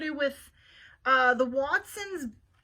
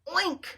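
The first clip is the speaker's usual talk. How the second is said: oink; 6 semitones higher than usual. Acted. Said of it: "said Mercy".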